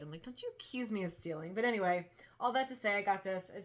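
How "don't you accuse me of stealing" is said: ordinary delivery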